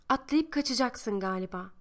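ordinary delivery, nothing marked

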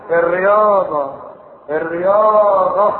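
slow, sneering الرياضه; mocking drawl. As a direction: drag out الرياضه with scorn. Mocking the excuse of sport.

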